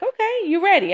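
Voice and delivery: a hint of God checking in on her; voicing God.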